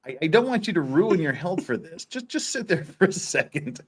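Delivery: usual speaking voice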